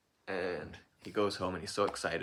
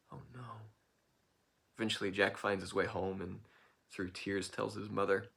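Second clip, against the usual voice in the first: ordinary delivery, nothing marked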